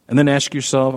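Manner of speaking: usual speaking voice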